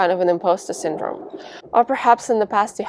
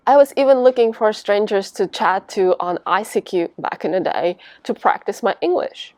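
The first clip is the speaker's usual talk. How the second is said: ordinary talk, nothing marked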